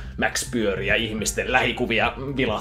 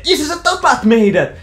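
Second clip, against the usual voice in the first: put-on high, loud, fast panicked teen yell; angry yelling son; 13 semitones higher, 8 dB louder than usual. Teen son yelling at his dad over the map, angry and high. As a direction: screech at dad that he'll get you killed.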